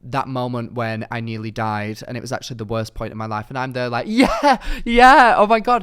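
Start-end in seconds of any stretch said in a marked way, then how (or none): 4.1-5.8 high, loud over-eager agreement; pretending to follow. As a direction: overdo the reaction as if you heard it all. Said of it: "I was pretending that I could tell".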